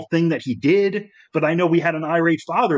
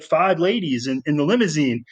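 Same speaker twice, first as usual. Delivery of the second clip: ordinary delivery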